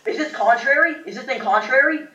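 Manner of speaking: high, rising incredulous question; alarmed questioning. Real, not acted. Player suspecting the Malamar is contrary, high and alarmed. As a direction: ask it high, lifting at the end. Dawning realisation of opponent's ability.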